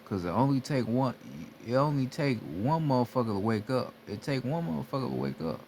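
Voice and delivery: ordinary talk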